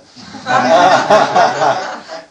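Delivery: a loud burst of real laughter at the question. Real, not acted. Guide laughs at a visitor's question, loud real laughter. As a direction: laugh out loud, caught off guard.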